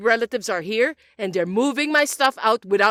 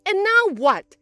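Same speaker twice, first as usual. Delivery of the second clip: indignant outburst; 8 semitones higher, 4 dB louder, slower than usual. Acted. Mother scolds daughter-in-law.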